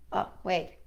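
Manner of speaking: plain reading voice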